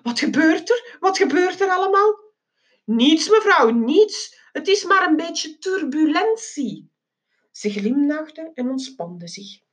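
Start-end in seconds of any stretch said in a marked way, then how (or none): 0.0-2.2 high-pitched worried questions; high, anxious lady mouse; muttering lady mouse. A narrator's reading. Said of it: "De muisin naast mij mompelde". 2.8-6.9 higher-pitched placating voice; lightly voiced reassurer; reassuring reply. A narrator's reading. Reassuring a worried passenger.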